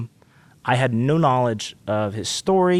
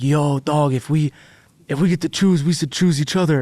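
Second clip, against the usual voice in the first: friendly bro voice, a touch up; Ian impression; 3 semitones higher, 3 dB louder than usual. Telling the interviewer how Ian pitched the duet, mild bro impression. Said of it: "that's my Ian impression".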